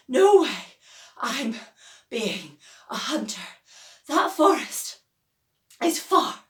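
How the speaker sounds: high, loud, puffed-out protest; out of breath, exaggerated whine; breathless complaint. A narrator's reading. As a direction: gasp out the grumble after the climb. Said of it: "she pants".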